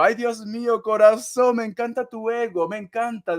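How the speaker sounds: ordinary delivery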